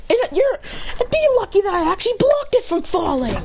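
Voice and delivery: high-pitched indignant justification; defiant comeback. Acted. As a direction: argue back in a high voice. Child argues back.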